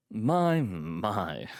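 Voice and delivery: mock amazement, smirk in the voice. A narrator's reading. Visitor looks over the ugly doorman, smirking.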